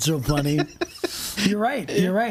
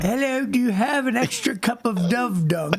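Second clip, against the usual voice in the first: higher-pitched comic Cockney; mock Cockney accent; 6 semitones higher than usual. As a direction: put on a silly, uncertain Cockney accent. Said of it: "Cockney accent".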